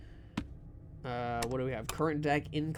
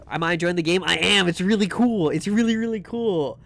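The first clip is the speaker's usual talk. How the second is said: excited; 7 dB louder than usual. Real, not acted. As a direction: gush about how cool it is. Enthusing to chat.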